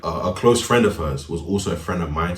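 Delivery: ordinary talk, nothing marked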